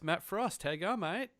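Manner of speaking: ordinary talk, nothing marked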